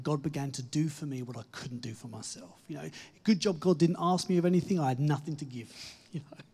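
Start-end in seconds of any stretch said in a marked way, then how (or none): 5.7-6.4 breathy laugh through the words. Real, not acted. Preacher laughs at having nothing to give, laughing. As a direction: chuckle it out. Laughs after own joke.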